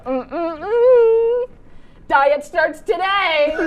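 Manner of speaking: slow, smug savoring hums then a taunt; gloating revenge. Pretend. Eating Michelle's pie to taunt her, slow smug gloating. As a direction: relish the stolen pie out loud. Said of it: "Can you be Michelle for a second?".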